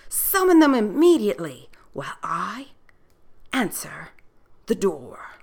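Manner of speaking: urgent hushed whisper. Acted. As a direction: hiss the order under your breath. Doorman urgent hushed order.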